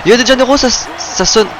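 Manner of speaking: high, loud finisher call; excited commentator shout. Real, not acted. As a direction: hype the finishing move.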